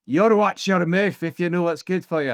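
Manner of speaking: mock-threatening Scottish grandfather; Scottish grandad impression. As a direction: tell someone off like a Scottish grandad. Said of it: "accent impression".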